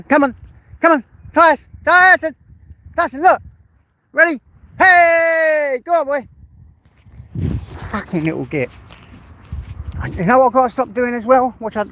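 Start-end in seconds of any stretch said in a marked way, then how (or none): 0.0-6.3 high, loud, excited calls; calling the dog. Real, not acted. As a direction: shout to rouse a dog into running.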